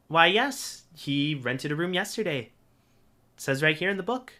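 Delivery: put-on cheerful young attendant. Sylvie confirming the booking to her coworker, high and cheery. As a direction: pitch it up for the eager young clerk.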